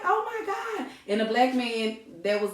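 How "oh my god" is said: high, laughing "oh my God"; overwhelmed by sweetness; awed. Retold or imitated. Woman moved by anniversary story, high and laughing. Touched reaction retold.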